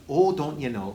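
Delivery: hammed-up Minnesota twang like Fargo; mock Fargo accent. Critic mocking an actor's American accent, hammed-up Fargo accent. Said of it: "he does sort of the Francis McDermott, uh, accent".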